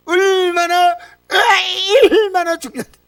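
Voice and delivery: high, slowed, half-laughing half-crying insistence; emotional insistence. Real, not acted. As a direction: plead its importance, voice cracking with feeling. Insisting worship matters, emotional.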